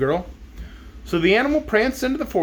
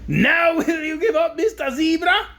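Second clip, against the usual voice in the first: high, slow taunting crab; jeering; 11 semitones higher than usual. Acted. Crab jeering at the zebra, high and slow. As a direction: gloat and jeer at the rival. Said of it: "it cried jeeringly".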